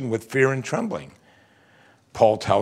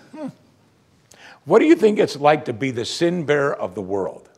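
normal talking voice

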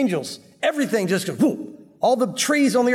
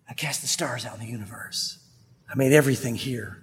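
whispered voice of Jesus; 8 semitones lower, 6 dB quieter than usual. Acted. Jesus answering in awe.

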